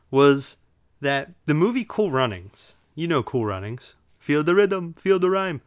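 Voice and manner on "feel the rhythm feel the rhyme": put-on higher-pitched, amateur Jamaican accent; poor Jamaican accent. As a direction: attempt a Jamaican movie chant, not very well. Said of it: "I don't do a good Jamaican".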